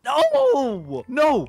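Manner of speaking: high, loud cry of No; shrill No, No; panicked shout. Real, not acted. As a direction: yell it out in panic. Panicking as friend dies in game.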